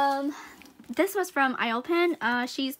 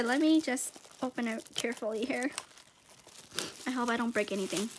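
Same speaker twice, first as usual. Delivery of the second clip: ordinary talk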